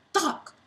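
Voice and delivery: sharp high thunk; thunk sound. Acted. Reader voicing the racket hit, sharp thunk.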